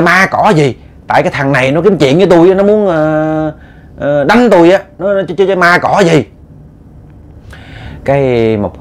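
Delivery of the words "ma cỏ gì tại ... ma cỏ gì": raised-pitch indignant retelling; acted-out wife refusing to listen; angry dismissal. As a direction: scoff heatedly and insist on the grievance. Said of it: "trong cái lúc bà nóng giận".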